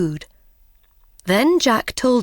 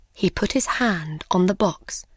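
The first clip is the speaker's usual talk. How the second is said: usual speaking voice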